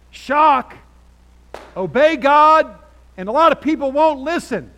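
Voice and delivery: high, shouted proclamation, stretched out; loud preaching. Real, not acted. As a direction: preach it at full volume. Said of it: "I'm loud".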